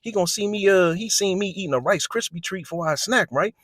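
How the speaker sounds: hammed-up angry youth, voice pushed up; a mock indignant teen complaining to the officer. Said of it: "So he was like".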